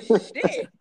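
high, laughing "shit"; laughing curse. Retold or imitated. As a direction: panic through laughter. Retold panicked thought, laughing.

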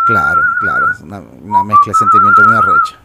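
very high, loud squeal of agreement; squeaky excitement. Acted. Friend reacting to band story, high loud squeal. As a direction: shriek it out like an overexcited fan.

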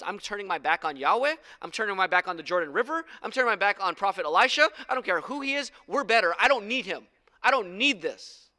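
loud, building refusal; acted proud general spurning the prophet; defiant. Naaman's first defiant thought voiced.

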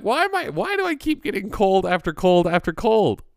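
high-pitched, whining voice; high whiny complaint. Retold or imitated. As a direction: whine it up high, exasperated. His own whiny retold complaint.

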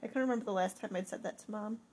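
usual speaking voice

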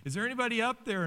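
high, pleading cries of help; man hanging from a cliff calls for help, high and pleading; crying out for help. Retold or imitated. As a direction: call up desperately from the cliff, pitched high. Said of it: "he's, like, crying out".

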